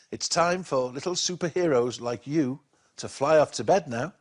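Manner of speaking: ordinary delivery, nothing marked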